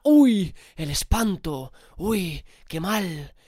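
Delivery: exaggerated gasp of dismay, high and loud at first; mock horror. Mocking social media's panic over a little belly, hammed-up horror. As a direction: overdramatize the shock, mockingly. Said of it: "Parece un drama de la hostia".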